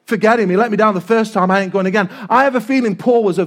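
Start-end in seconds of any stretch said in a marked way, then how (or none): none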